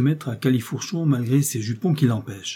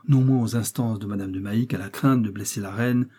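ordinary delivery, nothing marked

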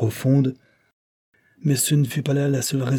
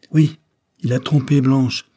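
usual speaking voice

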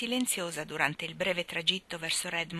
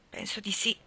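ordinary delivery

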